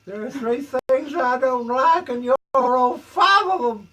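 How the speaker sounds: high, very loud Southern sheriff holler; slow, shouted Deep South lawman accent. Pretend. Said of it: "a deep South Sheriff accent".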